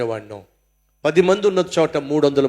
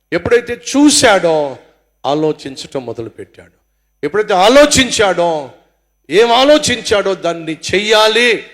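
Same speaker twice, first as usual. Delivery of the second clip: preaching shout; 2 semitones higher, 7 dB louder than usual. Real, not acted. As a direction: thunder it from the pulpit in bursts. Preacher building on David's fall.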